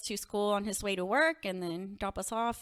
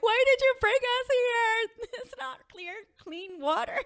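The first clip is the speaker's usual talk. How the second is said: mock crying kids; 13 semitones higher, 4 dB louder, slower than usual. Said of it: "we started crying".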